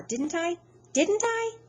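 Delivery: high, rising scold. A narrator's reading. Mother scolding her son, high rising scold. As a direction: pitch the question way up at him.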